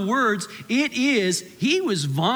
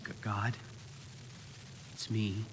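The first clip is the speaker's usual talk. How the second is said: much softer and lower, halting with long pauses; broken prayer; 9 semitones lower, 12 dB quieter than usual. Retold or imitated. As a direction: pray from rock bottom, barely holding together. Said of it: "your voice breaks through".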